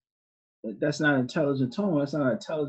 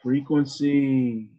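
Frequency stretched out very slowly, falling at the end; slow emphatic repeat; 4 dB louder, much slower than usual. Shown as a demonstration. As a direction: lean on Frequency, draw every syllable out slowly. Teacher repeats the key word.

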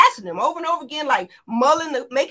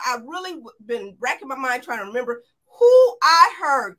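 usual speaking voice

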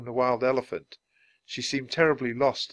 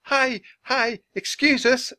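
put-on high, raised girl's call; yelled greeting; 13 semitones higher, 5 dB louder than usual. A narrator's reading. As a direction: hail passers-by from afar. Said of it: "Annie yelled".

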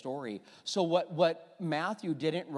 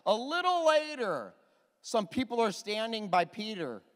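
full-force preaching; 7 semitones higher, 5 dB louder than usual. Real, not acted. Preacher retelling Peter's denials to congregation, full force. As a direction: preach it up high, stretching the key words.

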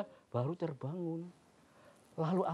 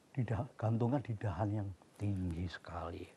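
hushed unvoiced whisper, softer; 6 semitones lower, 6 dB quieter than usual. A narrator's reading. Storyteller whispering to the listening children. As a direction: drop to a secret whisper.

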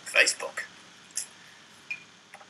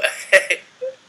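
loud high laughter; can't stop laughing; 13 dB louder than usual. Real, not acted. Still laughing at the friend, loud high laugh.

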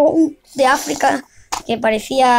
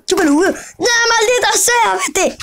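acted crying; 7 semitones higher than usual. Story figure breaks into crying.

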